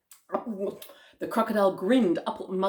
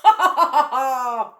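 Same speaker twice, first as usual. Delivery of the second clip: loud, villainous "ha ha ha"; crocodile belly laugh; 4 semitones higher, 9 dB louder than usual. Acted. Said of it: "the enormous crocodile laughed out loud".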